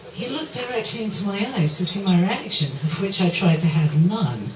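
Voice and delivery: usual speaking voice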